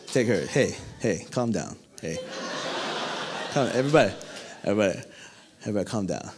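low, soft hushing to frightened men; Jesus quietly soothing his panicking disciples. Acted.

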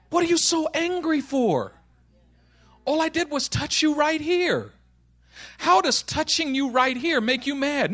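high, puzzled questioning; baffled protest. Acted. Friend asking Josh about his anger, baffled.

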